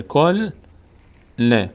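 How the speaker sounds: کل held as "kol", pause, then ل as "le"; two separate beats for کل، ل; split syllables. Shown as a demonstration. Teacher showing the lam read twice, syllables apart. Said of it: "یک بار با حرف ما قبل".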